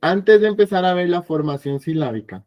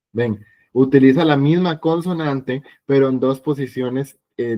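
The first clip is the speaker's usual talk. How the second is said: normal talking voice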